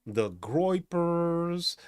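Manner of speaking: very slow, stretched sneer on Groypers; slow mocking Groypers; sneering drawl. Real, not acted. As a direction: drag out the name with contempt. Drawn-out sneer.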